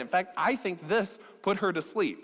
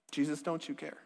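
much softer, hushed echo of the plea; 2 semitones lower, 7 dB quieter than usual. Acted. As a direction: say it almost under the breath, wounded. Disciples' plea, retold softly.

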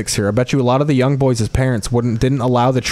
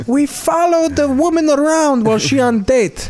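put-on Italian-American accent; 12 semitones higher than usual. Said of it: "I'm Jim Gandolfini".

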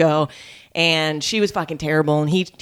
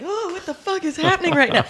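stunned disbelief; 13 semitones higher than usual. Retold or imitated. Shocked reaction in the club.

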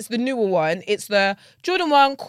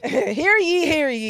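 town crier; 6 semitones higher, slower than usual. Pretend. Echoing the crier call.

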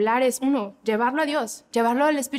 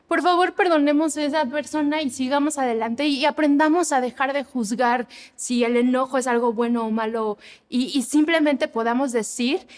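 ordinary delivery

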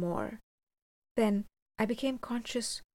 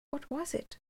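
higher, softer anxious question; in many keys; 7 semitones higher, 5 dB quieter than usual. A narrator's reading. Soldiers ask what attacked the stranger, higher and uneasy. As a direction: ask it with a wavering pitch. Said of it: "asked in all manner of keys".

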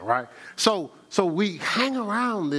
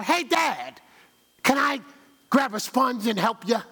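put-on eager little kid; 6 semitones higher than usual. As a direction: play the eager son tugging at Dad. Child voiced asking dad.

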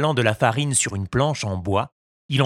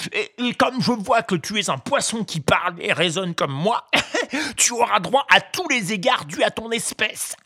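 high, mock-polite greedy ogre; a glutton feigning courtesy; sing-song fisherman; 10 semitones higher than usual. A narrator's reading. (voix d'ogre faussement poli)

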